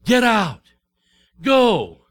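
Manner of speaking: loud, abrupt order to leave; commanding shout. Retold or imitated. Pharaoh shouting at Moses to get out, loud command. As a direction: bark it out like a king dismissing them. Pharaoh finally expels the people.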